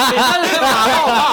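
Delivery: loud laughter mid-sentence; laughing. Real, not acted. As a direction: laugh through the words. Laughing at a bad answer.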